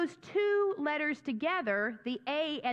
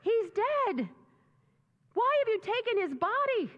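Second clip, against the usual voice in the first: high, loud anguished cry of grief; distraught anguish; 7 semitones higher, 3 dB louder than usual. Acted. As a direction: cry out in disbelief, pitched high. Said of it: "she is so distraught".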